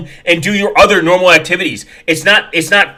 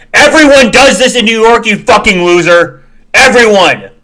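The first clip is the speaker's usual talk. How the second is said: loud, high rant at a commenter; angry yelling; 6 semitones higher, 6 dB louder than usual. Real, not acted. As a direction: bark it at the screen in disgust.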